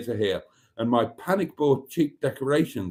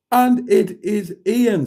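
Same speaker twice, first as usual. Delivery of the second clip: excited reveal; 10 semitones higher, 7 dB louder, slower than usual. Real, not acted. Reveals who got the gift.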